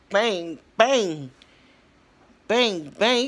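loud imitated bangs; mock banging sounds. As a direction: hit each bang like a hammer blow. Imitating the surgical drilling.

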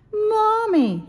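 high, loud call of distress; crying out. A narrator's reading. Little Owl has an awful thought, cries out to Mommy. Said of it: "he cried".

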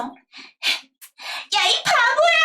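whispered yah, then a high sung-out 이 바보야; girl's voice. Retold or imitated. Girl in the story throwing a stone, mock sing-song cry. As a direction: play the sulky young girl throwing a stone. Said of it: "여자애니까 키 낮출게요".

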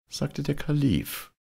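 ordinary delivery, nothing marked

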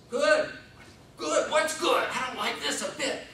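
high, loud fretful whine; panicky baby. Pretend. Newborn frets as the doctor says good. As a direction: fret anxiously, voice pitched high.

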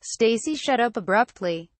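plain reading voice

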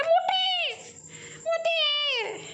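very high, long falling coo; cooing pet name. Real, not acted. Owner crooning at her fat cat, very high.

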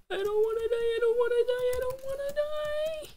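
mock-terrified high wail; panicked whine. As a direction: squeal it like you're terrified of dying. Panicked pleading as night falls.

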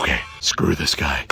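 very deep monster voice; Venom. Acted. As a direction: growl it low, like a comic-book villain. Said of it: "talking like this 'cause I'm Venom".

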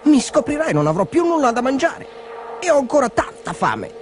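high-pitched plaintive dog; dog's worried thought. A narrator's reading. Hungry dog fears being found out, worried. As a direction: fret in a high little dog voice. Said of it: "pensò Orazio".

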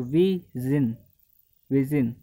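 विजन said slowly and clearly; slow vision demo. Shown as a demonstration. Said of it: "S I O N आ रहा है vowel के just बाद".

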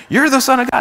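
high-pitched imitation of yelling; mock yell. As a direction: mimic demons crying out, pitch jumping way up. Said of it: "So they start yelling".

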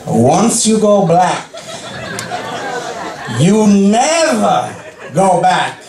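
slow, drawn-out saying; milk the old saying slowly; savoring each word of the punchline. Pretend. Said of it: "You know what they say".

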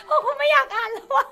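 high, loud laughing protest; squealed, giggly complaint; embarrassed wail. Real, not acted. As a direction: wail it, flustered by a cheesy line. Squirms after a cheesy pickup line.

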